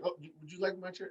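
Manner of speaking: high, soft, quick mock offer; Tony. Imitating a commentator offering his seat, eager soft voice. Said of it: "Tony's like".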